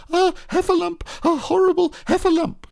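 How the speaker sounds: high fast panicked shriek; terrified squeal. Acted. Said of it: "cried Piglet".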